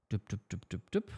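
dialing tone imitation. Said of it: "rufe ich jetzt mal an".

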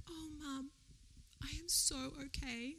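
a hint of a soft, teary child; tearful daughter. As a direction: lightly voice a daughter through happy tears. Crying daughter voiced by mum.

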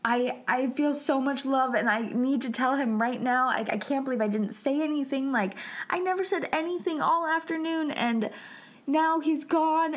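high, mounting distress; retold tearful panic, rising as it goes; panicked regret. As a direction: relive the panic, pitch climbing high. Retelling panicked, tearful regret at separation.

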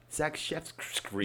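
"Zakshevsky" drawn out slowly and softly, syllable by syllable; careful name. Shown as a demonstration. Correcting the co-host on the runner's name, slow and careful.

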